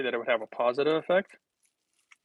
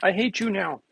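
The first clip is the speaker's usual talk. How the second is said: mock outrage; 8 semitones higher than usual. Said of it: "People are gonna be like".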